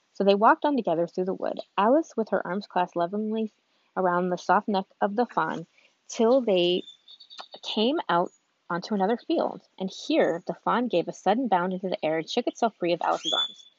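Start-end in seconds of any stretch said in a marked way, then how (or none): none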